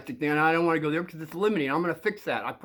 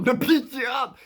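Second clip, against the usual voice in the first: higher, louder mock threat broken by laughter; cracking up; 9 semitones higher, 5 dB louder than usual. Real, not acted. Mock-threatening another kid, cracking up. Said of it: "I'm just trying to keep a straight face".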